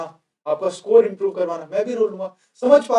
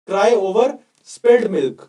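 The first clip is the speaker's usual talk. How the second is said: very slow and louder, word by word; slow drill for repeating; 6 dB louder, much slower than usual. Shown as a demonstration. Teacher leading the class to repeat aloud, slow and loud. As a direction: call it out slowly so the class echoes it. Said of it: "आप सारे मेरे साथ ही बोल रहे हो".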